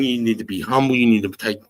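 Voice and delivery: ordinary talk, nothing marked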